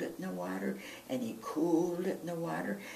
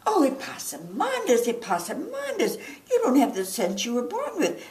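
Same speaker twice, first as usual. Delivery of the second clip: high, loud, exasperated laughing scold; 11 semitones higher, 8 dB louder than usual. Acted. Mama laughing in disbelief at her son, loud and high.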